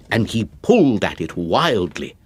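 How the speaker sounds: plain reading voice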